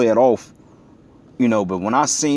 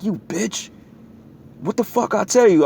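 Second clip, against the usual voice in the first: raised, higher furious yell; retold angry snap; 9 semitones higher than usual. Man reenacting yelling at his girlfriend, furious. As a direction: snap at her like you caught her. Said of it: "I looked down like".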